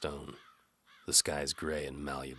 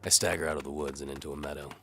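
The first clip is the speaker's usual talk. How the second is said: ordinary delivery, nothing marked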